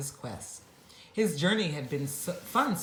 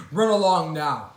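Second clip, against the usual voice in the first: gruffly called; 9 dB louder than usual. Acted. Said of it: "he called gruffly".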